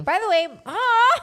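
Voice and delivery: high and loud flustered cry; excited squeal. Real, not acted. Flustered by declaration.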